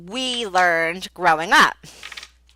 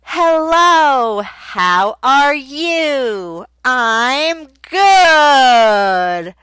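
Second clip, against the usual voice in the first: put-on cheerful kid teacher; 3 semitones higher, 7 dB louder, much slower than usual. Shown as a demonstration. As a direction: greet a young student brightly. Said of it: "Now, things like that".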